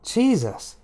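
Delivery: startled disbelief. Acted. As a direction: blurt it out in shock. Jane's startled inner exclamation.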